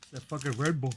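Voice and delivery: ordinary talk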